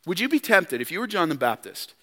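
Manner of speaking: ordinary talk, nothing marked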